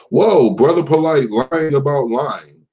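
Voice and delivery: normal talking voice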